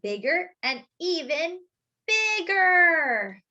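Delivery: bigger burst out high and loud; building excitement. Acted. Reading to kids, the dough swelling to a loud high bigger. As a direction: let the last bigger swell up big.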